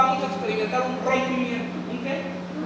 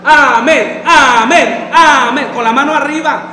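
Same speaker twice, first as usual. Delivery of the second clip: loud shouted amen, like Vera; 13 dB louder, slower than usual. Real, not acted. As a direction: shout it out as the example. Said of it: "Así como le está haciendo Vera". (amén gritado como Vera)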